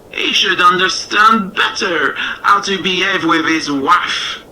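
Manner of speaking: strut through it like a vain cockerel; lightly voiced proud cockerel. A narrator's reading. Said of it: "And the proud cockerel replied".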